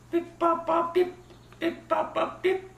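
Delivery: high beeps and bops in rhythm; imitated code beeps. Shown as a demonstration. Other operator answers that he is here, imitated beeps. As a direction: voice the signal, pulse by pulse. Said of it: "which is".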